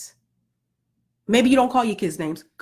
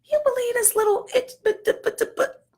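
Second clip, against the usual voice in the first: much higher, sputtering disbelief; mock outraged squeal. Mom griping about her child behind closed doors, squeaking. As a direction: squeak out the outrage, trailing into stammered buts. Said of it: "I say things like that".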